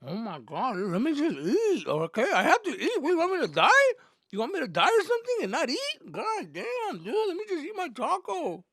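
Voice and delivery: very high, loud mock whine; whining son. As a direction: plead like a son caught red-handed. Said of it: "I'm like".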